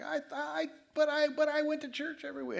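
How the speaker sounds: high, thin panicked squeal of a caught churchgoer; high desperate mock wail at the gate of heaven; mock panicked plea. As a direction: squeak it out like a doomed hypocrite. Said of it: "take him away screaming".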